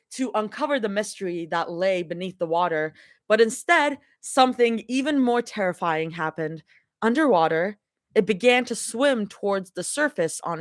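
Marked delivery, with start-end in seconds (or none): none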